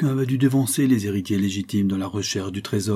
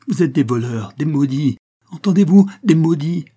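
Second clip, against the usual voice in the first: much louder, higher accusing shriek; frenzied cursing scream; 6 semitones higher, 8 dB louder than usual. Acted. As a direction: curse them in terror. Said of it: "cris d'épouvante".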